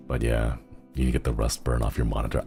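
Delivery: ordinary delivery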